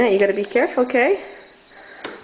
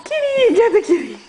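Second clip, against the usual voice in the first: high sing-song urging; 9 semitones higher, 4 dB louder than usual. Real, not acted. Urging toddler toward cat.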